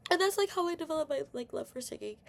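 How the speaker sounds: ordinary delivery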